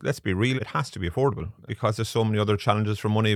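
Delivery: ordinary delivery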